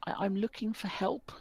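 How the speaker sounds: small whispered plea, pitched high; a frightened boy barely daring to ask; timid whisper. Acted. Lost boy whispers to the tall stranger. Shy boy asks for help.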